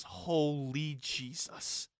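slow, drawn-out disbelief; awed chef. Retold or imitated. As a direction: stretch it out like a stunned chef. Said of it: "he goes".

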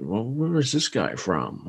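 slow, drawn-out imitation of American speech; imitated American. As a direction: lightly put on an American accent. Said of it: "the Americans will".

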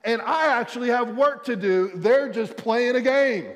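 high, loud comic indignation; mock exasperated rant. Dad protesting about charger thieves at home, mock exasperated.

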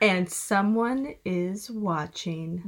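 slow, ominous trailer announcer; movie trailer voice. Pretend. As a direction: draw it out like a thriller trailer. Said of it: "like, movie trailer voice".

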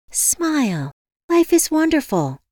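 high, chirpy sign-off; cheery receptionist. A narrator's reading. Receptionist signs off to stressed caller, cheery.

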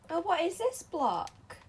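surprised. Real, not acted. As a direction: wonder aloud, surprised. Surprised by block.

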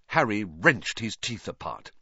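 ordinary delivery, nothing marked

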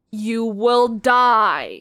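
slow, drawn-out doom warning; slow ominous. Pretend. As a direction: drag out each word ominously. Slow spooky refrain.

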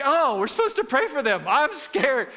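high, hammed-up nervous voice; mock scared. Believer seeing a limping stranger, mock scared and high. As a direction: play up the fear of praying for someone. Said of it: "and you're like".

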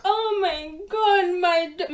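high, drawn-out mock wailing; dramatic grandmother. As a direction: imitate an attention-seeking, melodramatic grief. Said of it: "whole dramatic thing".

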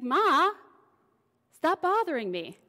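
loud, high exasperated brush-off; annoyed son. Retold or imitated. Jesus brushing off his mother, loud and annoyed. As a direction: snap back at a nagging mother, loud and high. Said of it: "And he's like".

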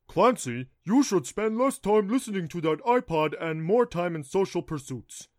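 loud, lecturing dad voice; imitating dad. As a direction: lecture like a stern father. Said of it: "Mine is all".

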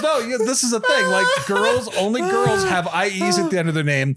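a hint of a know-it-all coworker; imitating Kirk. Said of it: "He goes".

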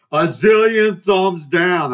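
put-on high, loud, drawn-out kid verdict; emphatic little brother. Little brother judging the rival university, emphatic. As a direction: stretch it out like a child declaring.

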